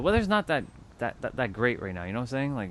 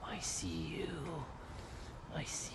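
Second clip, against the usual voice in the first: creepy whisper; 4 semitones higher, 19 dB quieter, much slower than usual. Pretend. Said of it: "just be like".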